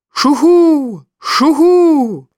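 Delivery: very high, loud calling hoot. Acted. Eddie calls the mountain jackdaw to come, loud high hoot. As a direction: call it out to the bird far away. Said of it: "Dann ruft Eddie".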